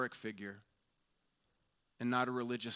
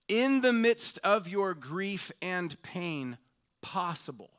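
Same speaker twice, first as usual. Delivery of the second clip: slow, loud and higher, pressing each phrase; full-force preaching; 6 semitones higher, 8 dB louder, slower than usual. Real, not acted. Preacher urging grieving listeners, slow loud fervent appeal. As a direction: drive it home with rising fervor.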